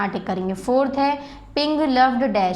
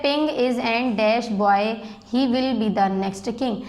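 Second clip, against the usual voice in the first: very slow, word by word, for students to write; slow dictation; much slower than usual. Shown as a demonstration. Teacher reading a worksheet line to the class, slow dictation. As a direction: read it out slowly so the class can copy.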